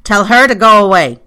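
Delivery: high, very loud shout; bawled order. Acted. Pyecraft orders visitor to dismiss housekeeper, bawling. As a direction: bawl it through the shut door. Bawls from behind the locked door.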